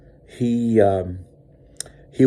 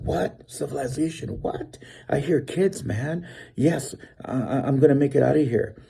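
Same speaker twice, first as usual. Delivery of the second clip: retold excited disbelief; 5 semitones higher than usual. Lost man retells hearing kids nearby, high excited relief. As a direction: react as if rescue has suddenly appeared, pitched up.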